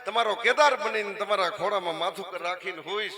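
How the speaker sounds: fervent promise. Acted. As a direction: promise the mother joyfully and loudly. Saint comforts old mother.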